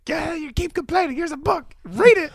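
lightly voiced strict mother; scary librarian mom. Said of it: "Mom sounds like the scary librarian".

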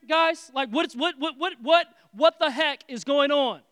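loud, high, exasperated what-is-this-noise rant; bursting in on the party, fed up; indignant outburst. Acted. Older brother yelling at the party noise, loud and outraged.

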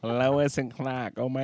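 "Lois and Clark" drawn out, Boston style; slow Boston accent for "Lois and Clark". Acted. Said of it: "they're from Boston".

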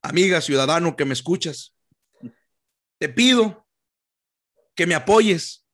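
earnest candidate addressing voters; campaign speech voice. Pretend. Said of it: "lo voy a hacer acá".

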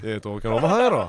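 mock Kansai bickering; Kansai dialect. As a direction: insist stubbornly in put-on Kansai. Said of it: "関西弁で、".

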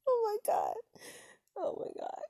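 squealing with laughter; high laughing. Real, not acted. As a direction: laugh high through oh my God. Laughing at singing.

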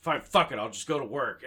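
loud retold huff of exasperation; angry giving in. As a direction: blurt it out, fed up and giving in. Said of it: "that made me angry".

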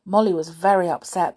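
usual speaking voice

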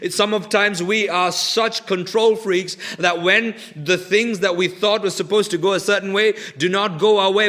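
usual speaking voice

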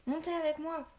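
high, pressing shout; urgent call. Acted. Boy calls the warriors onto Bayard, urgent shout. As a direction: get them up now.

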